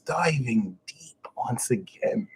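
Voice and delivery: slow whispered sign-off; imitating his half brother. Guru imitating the brother's catchphrase, slow whisper. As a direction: whisper it slowly like a calm video host. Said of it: "he used to start saying it in his videos".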